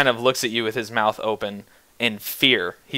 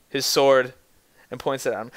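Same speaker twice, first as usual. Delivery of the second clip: ordinary delivery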